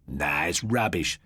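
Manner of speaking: slow, scoffing; boy brushing off ghosts, slow and scornful; scornful dismissal. A narrator's reading. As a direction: drag it out with contempt. Jeff dismisses it.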